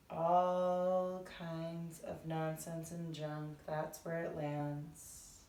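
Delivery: soft, measured meditation voice; guided meditation. Acted. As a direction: ease listeners gently into breathing, unhurried.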